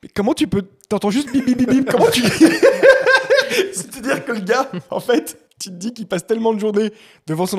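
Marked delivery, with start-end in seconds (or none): 0.9-1.9 mock beeps. Mocking the beeps, rising disbelief. 1.9-5.4 high burst of laughter; laughing. Real, not acted.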